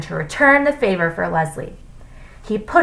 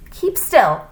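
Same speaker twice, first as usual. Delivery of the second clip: higher, firm command; ordering; 5 semitones higher, 4 dB louder than usual. A narrator's reading. Teacher to a nodding pupil, ordering. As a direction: order it sharply. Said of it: "ordered Missus Jules".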